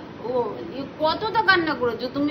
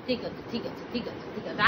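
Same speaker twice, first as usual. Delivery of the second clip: low, softer reassurance; quiet soothing okay; soft comfort; 6 semitones lower, 12 dB quieter than usual. Real, not acted. Comforting the crying child.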